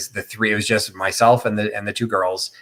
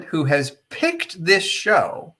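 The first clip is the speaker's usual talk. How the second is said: ordinary delivery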